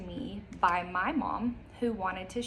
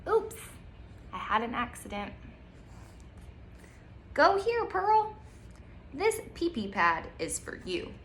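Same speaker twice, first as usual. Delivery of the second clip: bright storybook reading, slow on the details; reading to children; 2 semitones higher than usual. Acted. Teacher reading a picture book to kids, lively storytime voice. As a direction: read it aloud like storytime.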